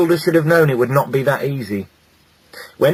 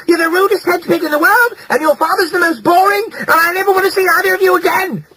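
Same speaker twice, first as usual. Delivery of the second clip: much higher, loud scream; 4 dB louder than usual. A narrator's reading. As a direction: lose your temper completely. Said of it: "she screamed".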